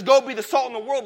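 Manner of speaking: a loud preacher's call; ringing command. Retold or imitated. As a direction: proclaim it as a charge to the church. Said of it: "Jesus says".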